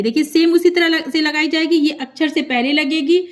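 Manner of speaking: usual speaking voice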